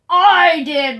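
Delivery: loud barked boast from above. Acted. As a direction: bark it down at them. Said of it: "barked a voice from above".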